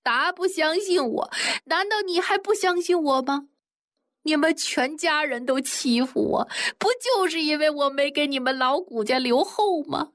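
high, tearful, near hysterical; crying outburst. A narrator's reading. Wife threatening to die in front of the family, high tearful wail. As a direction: wail it out, wronged and desperate. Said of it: "一边哭还一边捶打".

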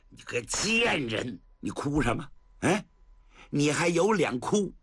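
scorn her, then explode; vicious abuse. Acted. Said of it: "薛丁山又来劲儿了". (恶狠狠地骂到狂吼)

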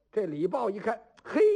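ordinary delivery

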